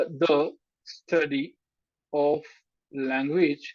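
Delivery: very slow, word by word book title; slow dictated title. Shown as a demonstration. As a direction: dictate the title slowly for notes. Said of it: "एक मैं किताब आपको recommend कर देता हूँ". (किताब का नाम धीरे-धीरे लिखवाना)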